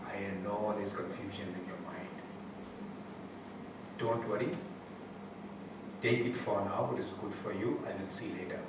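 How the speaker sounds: very soft, low and tender, with long pauses; hushed guru comforting a troubled follower; compassionate reassurance. Retold or imitated. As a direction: soothe gently, almost under the breath. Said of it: "compassionate, beautiful, blissful smile".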